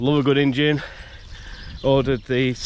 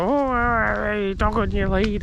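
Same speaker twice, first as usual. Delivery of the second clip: a higher mock-prim neighbour voice; imitating the neighbour; 9 semitones higher, slower than usual. Imitating the dog owner's warning, a little higher. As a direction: lightly mimic her fussy warning. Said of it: "She was like".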